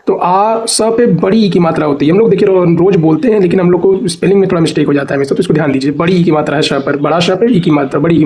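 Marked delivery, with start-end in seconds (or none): none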